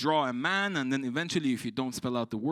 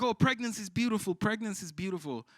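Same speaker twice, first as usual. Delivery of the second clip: mock gushing; 7 semitones higher than usual. Mocking what everybody says.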